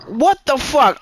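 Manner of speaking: high-pitched incredulous outburst; retold indignant disbelief. Man recounting his reaction to partner's touch, indignant. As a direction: throw it out in mock disbelief.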